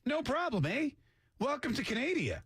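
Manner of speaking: high, easygoing mock Canadian; mock welcoming Canadian. Canada letting grandfather right in, mock cheery Canadian. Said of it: "Canada, let him right in".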